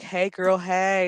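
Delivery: high, level singsong hello; mock chummy greeting. Voices two characters greeting each other.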